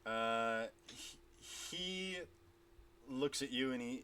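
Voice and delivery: usual speaking voice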